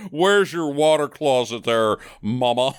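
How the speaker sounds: slow, hammed-up Southern drawl on British words. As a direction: drawl it like a cartoon Southern singer. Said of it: "Way to go, [name]".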